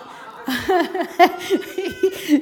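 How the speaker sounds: high, loud burst of laughing; laughter. Real, not acted. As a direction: laugh out loud at being teased. Teased about her bad patois.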